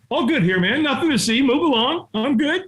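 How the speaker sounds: high, too-quick insistence that all's fine; mock false reassurance. Hiding man to the helper, falsely reassuring. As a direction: wave someone off in a high voice. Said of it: "when we're not okay, what do we say".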